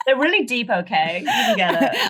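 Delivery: mock the boyfriend's pretension; mock earnest boyfriend.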